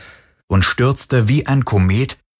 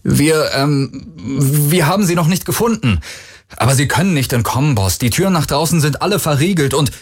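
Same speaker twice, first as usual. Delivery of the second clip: higher, fast and anxious excuses; nervous henchman; 7 semitones higher than usual. Acted. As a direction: babble to placate the boss. Handlanger stammelt vor dem Boss. (nervöser Handlanger, hoch und hastig)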